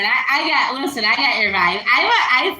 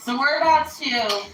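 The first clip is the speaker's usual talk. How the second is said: ordinary talk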